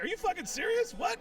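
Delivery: very high-pitched disbelief; incredulous. Retold or imitated. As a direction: squeal the question in mock astonishment. Acts out disbelief at the confession.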